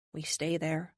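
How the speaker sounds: ordinary delivery, nothing marked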